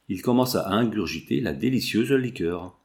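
plain reading voice

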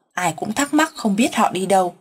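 plain reading voice

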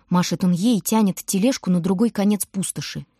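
plain reading voice

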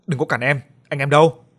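rising to a loud call for the gang; rallying shout. Acted. Young thug calls his gang to arms, shouting.

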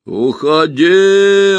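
slow, drawn-out booming creature call; muffled monster voice. A narrator's reading. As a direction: loom over the forest with a hollow voice. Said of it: "ответило чудовище".

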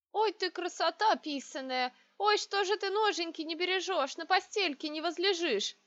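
high, sing-song wailing of a plump matron; lamenting old matron. A narrator's reading. Big lady fussing over the bride, high lamenting wail. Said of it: "запричитала".

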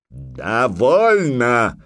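loud, long drawn-out roar. A narrator's reading. Jarl roaring to silence the mocking giant. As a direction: cut him off with a roar. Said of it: "взревел Храгнир".

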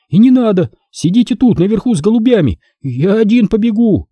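put-on high, lively boy's voice, calling out; a stubborn younger brother who won't wait; boy's indignant shout. Younger brother shouting at his brothers, indignant boy's voice.

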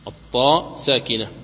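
ordinary talk

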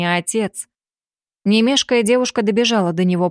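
plain reading voice

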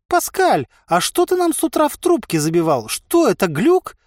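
high-pitched, astonished merchant; bewildered questioning. A narrator's reading. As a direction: ask in high, baffled disbelief.

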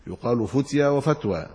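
ordinary delivery

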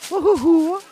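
put-on very high, loud girlish whoop; admiring exclamation. A narrator's reading. Girl admiring a boy's huge bundle of firewood, delighted. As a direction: exclaim in delighted awe, voice shooting up. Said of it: "захоплено сказала".

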